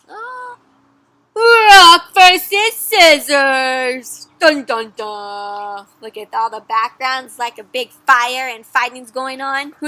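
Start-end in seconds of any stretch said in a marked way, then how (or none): none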